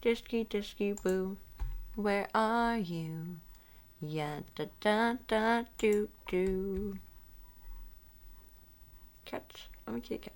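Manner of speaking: ordinary delivery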